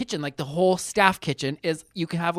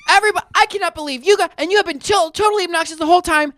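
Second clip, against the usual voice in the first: high, fast imitated rant; mock screaming; 9 semitones higher, faster than usual. Old man ranting at the whole table, mock screaming. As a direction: rattle off a high, outraged tirade. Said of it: "Now he's screaming".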